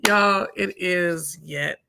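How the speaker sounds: normal talking voice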